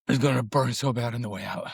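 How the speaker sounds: normal talking voice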